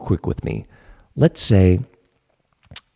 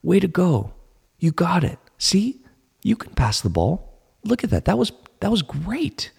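encouraging self-praise; 8 semitones higher than usual. Retold or imitated. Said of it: "he would compliment himself".

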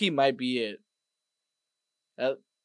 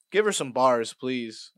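normal talking voice

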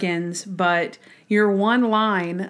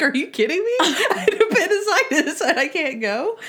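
higher, laughing mock outrage; laughing disbelief; 8 semitones higher than usual. Left off the field trip, laughing in mock outrage. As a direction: laugh through the indignant complaint.

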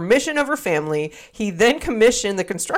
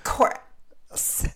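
failed seductive; 8 dB quieter than usual. Shown as a demonstration. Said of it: "It's like".

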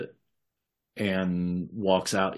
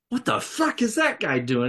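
pitched-up, alarmed gangster yell; startled thug; 12 semitones higher than usual. Acted. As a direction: catch sight of movement and snap out in alarm. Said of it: "He's like".

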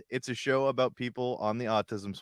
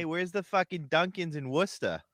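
higher-pitched mock Massachusetts local; Massachusetts accent; 6 semitones higher than usual. Local asking the way to Dunkin's, hammed-up Massachusetts accent. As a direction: call out like a Massachusetts townie. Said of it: "you gotta say it with an accent".

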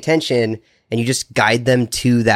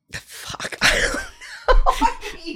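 whispered curse into giggling; laughing; much slower than usual. Real, not acted. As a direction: laugh it off, stumped. Stumped, bursts out laughing.

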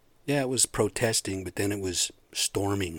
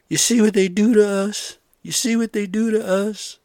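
much higher and louder, a hammed-up sobbing rant; mock crying wail; 12 semitones higher, 8 dB louder than usual. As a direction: wail it like an overgrown crybaby. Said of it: "just crying and screaming at the people".

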